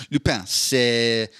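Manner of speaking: a slow mock review with a French accent; hammed-up French accent. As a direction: savour each French word, unhurried. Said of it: "my review en français".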